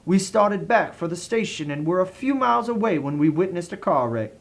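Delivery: plain reading voice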